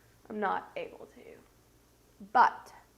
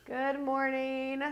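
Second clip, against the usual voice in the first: slow, moderately raised "Good morning"; restrained singsong greeting; 4 semitones higher, slower than usual. Real, not acted. Congested vlogger greeting viewers a second time, restrained singsong greeting. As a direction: greet slowly without pushing the voice up too far. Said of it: "I can't raise my voice that much".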